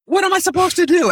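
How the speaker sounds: very high-pitched mock exasperation; squeaky-high hammed-up protest; joke punchline voice. Acting out the joke's punchline.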